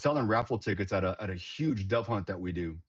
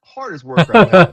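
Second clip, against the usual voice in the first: much louder and higher, bursting out; emphatic shout; 10 semitones higher, 20 dB louder than usual. Real, not acted. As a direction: shout her praise. Praising the girl, exclaiming.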